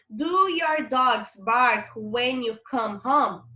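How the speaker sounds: slow, deliberate English sentence; slow classroom reading of the English question; slow model reading. Shown as a demonstration. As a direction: read it slowly so students follow. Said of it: "teacher reads exercise sentence aloud".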